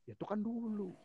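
high, mocking imitation of the ex. Mimicking her ex brushing her off, high and mocking. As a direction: toss it off in a high, snotty voice like the ex did.

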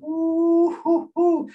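high falsetto "ooh-hoo-hoo" whoop; loud, high hooting in delight; hyped whooping. Retold or imitated. Fan re-enacts his reaction to the slashes, hyped high whoop.

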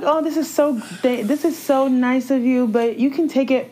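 high-pitched sugary gratitude; mock gushing politeness. Mimicking someone politely accepting money from adults, mock gushing politeness.

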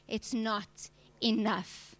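slow, weighty not enough; grave, deliberate repetition; slow emphatic repeat. Real, not acted. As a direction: let each word land slowly. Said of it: "repeated slow emphasis".

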